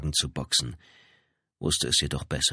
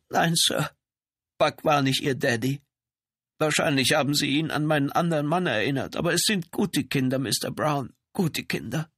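high, pitched-up woman's character voice; frightened trailer woman; 9 semitones higher than usual. Acted. Woman defends her children to the policeman, high-voiced.

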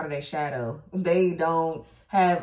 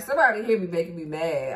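usual speaking voice

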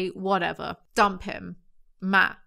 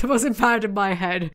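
ordinary delivery

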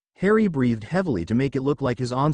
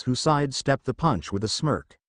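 ordinary delivery

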